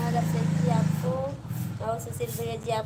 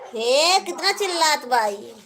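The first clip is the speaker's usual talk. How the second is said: loud, raised call across the room; 4 semitones higher, 6 dB louder than usual. Real, not acted. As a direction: call out sharply to someone nearby. Scolding someone nearby, loud call. (ज़ोर से पुकारती हुई)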